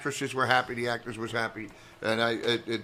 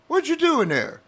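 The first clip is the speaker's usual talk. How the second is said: high-pitched, incredulous retold question; astonished agent; 11 semitones higher than usual. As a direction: imitate an amazed agent on the phone. Voicing his agent on the phone.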